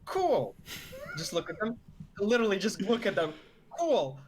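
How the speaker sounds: dry sarcastic cool. Acted. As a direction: say it deadpan, unimpressed. Player reacts to thugs.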